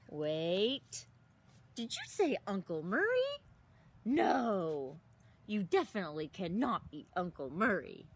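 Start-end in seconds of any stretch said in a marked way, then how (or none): none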